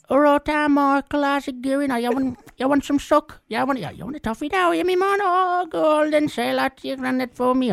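put-on squeaky old lady sucking toffees; hammed-up old neighbour greeting a boy; Missus Timmins impression. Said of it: "give us Missus Timmins".

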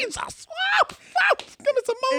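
high, squealing delight; savoring delicious food. Retold or imitated. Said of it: "And then you taste it and you're like".

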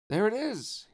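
high, loud excited shout; excited cry. A narrator's reading. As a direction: shout it out in wonder. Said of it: "he cried".

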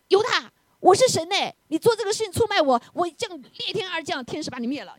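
high-pitched mock divine outrage; hammed-up high scolding, easing off at the end; mock threat. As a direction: act out an indignant, overblown threat. Imagined Jesus threatening Judas.

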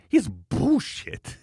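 put-on high, drawn-out Ukrainian-accented exclamation. Quoting the next-door neighbor's favourite curse, in a Ukrainian accent. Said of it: "his Ukrainian accent, what he says".

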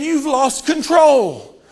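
slow mocking devil's jeer. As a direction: drag out the taunt like a gloating devil. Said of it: "and mocking and saying".

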